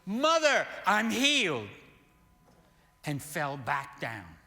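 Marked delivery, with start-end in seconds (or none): 0.0-1.7 high, very loud cry of healing; retold scream. Sick man screams to his mother that he's healed, retold scream. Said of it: "and he screamed".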